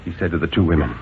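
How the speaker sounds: ordinary delivery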